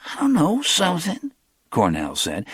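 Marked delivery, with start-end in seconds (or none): 0.1-1.3 higher, quick street talk; lightly voiced young hustler; Cornell's voice.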